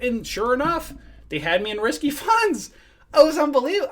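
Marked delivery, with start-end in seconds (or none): 1.3-3.9 indignant outburst. Real, not acted. Outraged at risky funds.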